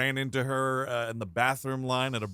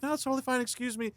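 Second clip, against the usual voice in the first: higher, polite, light voice; 10 semitones higher, 4 dB quieter, faster than usual. Retold or imitated. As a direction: brush it off politely, high. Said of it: "she was like".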